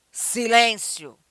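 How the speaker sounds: loud, stretched order; emphatic call for silence; firm command. Shown as a demonstration. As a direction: demand silence forcefully. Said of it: "o tom".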